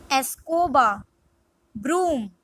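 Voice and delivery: usual speaking voice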